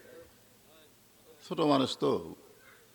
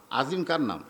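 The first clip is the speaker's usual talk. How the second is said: ordinary talk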